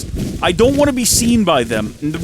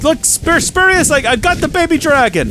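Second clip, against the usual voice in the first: much higher, louder eager calling; excited call; 10 semitones higher, 4 dB louder than usual. Real, not acted. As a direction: call out to get his attention. Calling out to Spurious.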